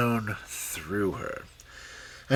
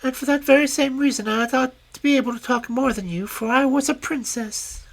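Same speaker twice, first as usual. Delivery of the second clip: much higher small insect voice; a cheeky tiny grasshopper; lightly squeaky grasshopper princess; little grasshopper; 13 semitones higher than usual. Acted.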